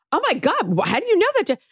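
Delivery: high, gasping disbelief; mock shock. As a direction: imitate Lexi stunned. Voicing Lexi's shock.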